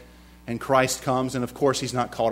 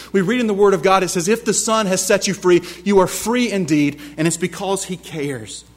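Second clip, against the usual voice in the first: higher, louder fervent sermon climax; preaching at full force; 6 semitones higher, 4 dB louder than usual. Acted. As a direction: lift the congregation with rising passion. Preacher builds again.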